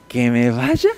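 low, scornful echo of the order; mocking disbelief. Defiant demon mocks Jesus's order, scornful and low. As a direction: sneer it back like a defiant demon. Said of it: "le dice así como de".

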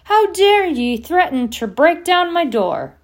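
high, loud outraged bellow; angry roar. A narrator's reading. Hagrid outraged at a threat to his door, roaring. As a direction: thunder at him for his cheek. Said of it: "he roared at Harry".

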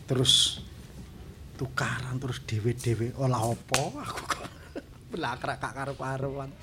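soft whispered soliloquy; whispered muttering to himself. Real, not acted. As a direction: whisper thoughts to yourself. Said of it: "Ngene iki ngudarasa".

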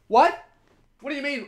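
high-pitched incredulous challenge; put-on high, indignant questioning; mock indignation. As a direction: act affronted at someone who didn't hear. Pretend quarrel with a listener.

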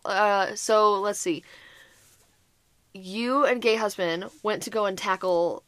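ordinary delivery

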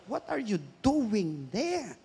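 soft, drawn-out, weighty question; quiet confronting question. Retold or imitated. Preacher voices God questioning the backslidden, slow and quiet. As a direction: stretch it out softly, letting it sink in.

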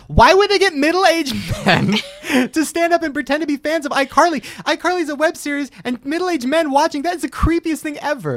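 high, loud incredulous outburst with laughter; outraged rant. Acted. Acts out outraged second thought.

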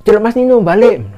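high-pitched holler; imitated calling out. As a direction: call someone back along the trail. Calling a hiker back. (menirukan teriakan memanggil balik)

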